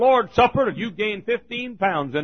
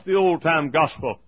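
normal talking voice

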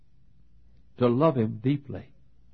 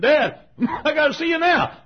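urgent plea; 8 dB louder than usual. Retold or imitated. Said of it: "He said".

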